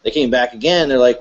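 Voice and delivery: normal talking voice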